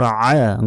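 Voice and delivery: slow pharyngeal "'aa" on আ; throaty ain. Shown as a demonstration. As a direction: constrict the throat for আ. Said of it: "গলার ভেতর থেকে".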